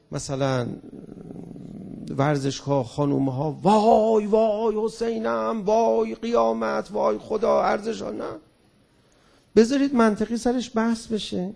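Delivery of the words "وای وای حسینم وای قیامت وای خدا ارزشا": a hint of wailing zealot; lightly mocking cry of woe, slowed; mock religious lament. Speaker mocks hardliners' outcry over women in stadiums, lament voice. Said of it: "کسی گفت".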